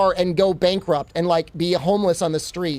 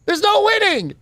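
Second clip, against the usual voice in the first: usual speaking voice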